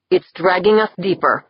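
usual speaking voice

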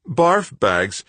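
pushing his voice up as loud as he can. A narrator's reading. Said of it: "raising his voice as loud as he could".

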